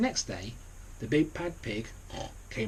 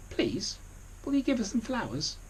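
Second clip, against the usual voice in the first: much higher pleading wolf voice; polite little wolves; 11 semitones higher than usual. A narrator's reading. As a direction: ask sweetly in a tiny voice. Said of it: "said the little wolves".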